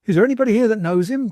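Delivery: high, loud and fast; frantic high cry to the room; excited cry. A narrator's reading. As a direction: burst in frantic, calling for help. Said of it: "cried the little man".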